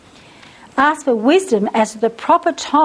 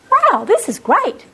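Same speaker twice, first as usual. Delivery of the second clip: mock delight; 9 semitones higher than usual. Husband's delighted thought.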